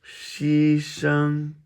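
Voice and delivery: "西生" held high and level; first tone. Shown as a demonstration. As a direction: sustain both syllables of "西生" on one high note. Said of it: "All first tones".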